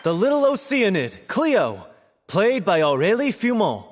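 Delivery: curtain-call announcer. Acted. Host introducing the cast to the audience, projected announcer voice.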